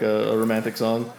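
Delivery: ordinary talk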